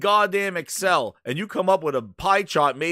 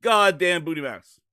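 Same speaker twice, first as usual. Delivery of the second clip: normal talking voice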